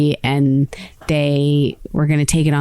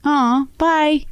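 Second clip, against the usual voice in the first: wistful goodbye; 9 semitones higher than usual. Retold or imitated. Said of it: "you have a moment of, like".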